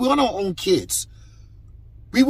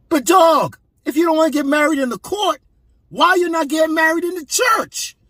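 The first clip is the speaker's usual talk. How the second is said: high, raised voice pressing the question; heated challenge; 7 semitones higher than usual. Real, not acted. Demanding of the unmarried man, a raised challenge. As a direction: push the question at him, voice up.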